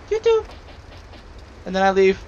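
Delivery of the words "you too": squeaky rat. Retold or imitated. Imagined rats saying goodbye.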